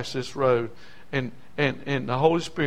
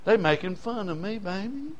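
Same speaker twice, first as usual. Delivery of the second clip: softer and softer, nearly hushed; hurt and soft to wife; 3 semitones higher, 6 dB quieter than usual. Retold or imitated. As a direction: confide to his wife, wounded. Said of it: "told his wife".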